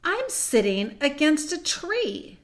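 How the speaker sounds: high, slow wonder; amazed. Acted. Susan finds she is sitting against a tree, amazed. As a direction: spell out an astonishing discovery.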